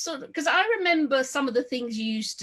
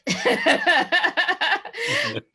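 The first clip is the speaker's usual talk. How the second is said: a big, high burst of laughter; hearty high laugh; loud laugh; 10 semitones higher, 7 dB louder than usual. Real, not acted. Laughing at son's impatience.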